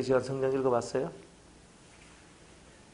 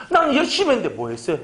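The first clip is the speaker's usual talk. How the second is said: higher, louder whining demand; whiny complaint; 8 semitones higher, 4 dB louder than usual. Retold or imitated. Believer whining at God, higher and louder. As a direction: nag like a spoiled believer.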